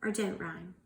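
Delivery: ordinary delivery, nothing marked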